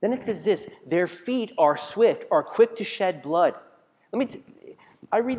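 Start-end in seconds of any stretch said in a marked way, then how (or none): 0.9-3.5 slow, loud and weighty; each word driven home to the congregation; solemn declaiming of the verse; scripture reading. Acted.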